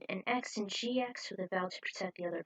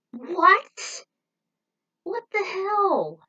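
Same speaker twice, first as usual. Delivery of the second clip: much higher, louder, slow stunned cry; shocked disbelief; 12 semitones higher, 11 dB louder, much slower than usual. Real, not acted. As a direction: gasp out the outrage. Reacting to the werewolf twist.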